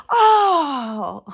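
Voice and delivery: high loud gasped oh; a crowd's shocked cry; sudden horrified oh. Retold or imitated. Crowd gasps at spider.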